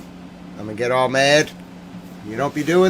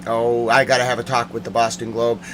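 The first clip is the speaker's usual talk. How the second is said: ordinary talk, nothing marked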